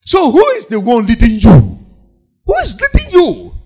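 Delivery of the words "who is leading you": rhetorical question, pitched high. Acted. Preacher asking congregation.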